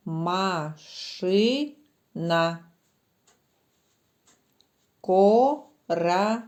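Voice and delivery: slow, syllable by syllable; split into syllables. Shown as a demonstration. Teacher spelling words slowly in syllables for children. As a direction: tap out each word in syllables for kids. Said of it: "просять слова поділити на склади".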